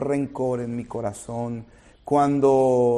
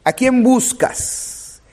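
much higher, slow, pleading question; urgent appeal; 8 semitones higher, 5 dB louder, slower than usual. Acted. Preacher pressing the question on the congregation, high and slow. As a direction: lift it high and stretch each word.

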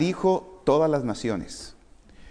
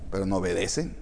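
dropped voice; 8 semitones lower, 7 dB quieter, faster than usual. Acted. Preacher drops voice for contrast.

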